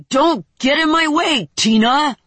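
loud, high angry yell; angry shout. Acted. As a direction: shout her down. Chad yells at Tina.

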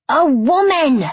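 high, loud shriek; child's shriek. A narrator's reading. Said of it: "child on a scooter shrieks at me".

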